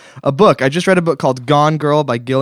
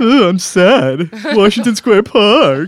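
goofy voice; 6 semitones higher, 6 dB louder, slower than usual. Pretend. Mocking reading of his own story.

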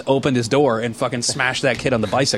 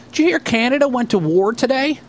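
high, naive mock news-breaking; Ray voice; 10 semitones higher than usual. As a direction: imitate a clueless kid sharing news. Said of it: "He goes, uh".

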